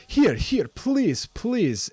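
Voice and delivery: put-on high, urgent accented pleading; excited shopkeeper. Shopkeeper rushes back out with a reward, high eager pleading. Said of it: "she says".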